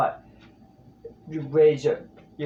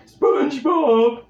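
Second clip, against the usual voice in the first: high, slow dopey call; Patrick voice; 11 semitones higher, 6 dB louder, slower than usual. Shown as a demonstration. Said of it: "drop in your larynx".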